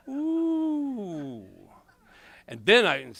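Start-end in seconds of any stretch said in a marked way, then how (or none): 0.1-1.4 high laughing ooh, held long; laughing whoop. Real, not acted.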